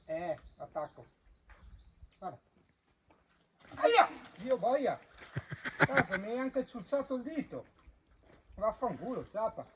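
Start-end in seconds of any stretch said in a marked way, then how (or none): 3.8-7.6 sharp high yelp, then cursing; real yelp of pain. Real, not acted. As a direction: yelp as the finger gets bitten. Bitten by an animal. (urlo di dolore)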